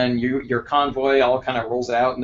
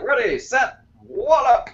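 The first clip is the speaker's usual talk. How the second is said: put-on high, crackly old-timey announcer call; crackly vintage cartoon announcer; 12 semitones higher, 3 dB louder, slower than usual. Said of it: "that crackly, like, old-timey voice".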